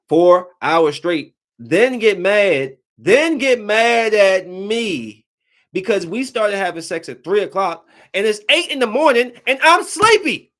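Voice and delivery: high, loud, drawn-out complaint; exasperated. Real, not acted. Complaining to listeners about the girlfriend, slow, high, exasperated. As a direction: stretch it out in disbelief.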